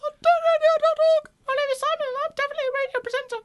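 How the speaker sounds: squeaky sped-up DJ voice; high, fast cartoon radio host; radio presenter voice. Mock radio presenter voice bit.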